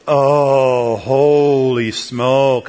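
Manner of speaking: slow, drawn-out groan of disgust. Retold or imitated. Passenger hit by seatmate's breath, slow disgusted groan. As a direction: recoil slowly from the bad breath. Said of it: "I'm like".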